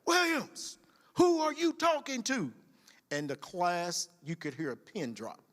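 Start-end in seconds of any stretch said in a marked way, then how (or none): 0.0-2.5 high instructor yell, mock sternness; retold drill yell. Instructor to Williams, high retold yell. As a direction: bark the question at the recruit. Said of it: "And I yelled back".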